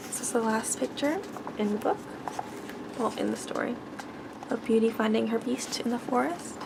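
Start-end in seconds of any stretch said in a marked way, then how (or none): none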